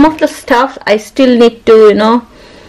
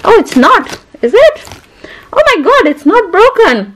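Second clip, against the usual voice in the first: surprised; 10 semitones higher than usual. Real, not acted. Finds makeup unbroken.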